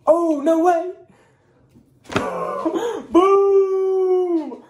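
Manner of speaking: high, loud thrilled gasp and shout; gasp, then belt it out; excited. Real, not acted. Spotting a Molina card in the pack, thrilled shout. Another case hit revealed.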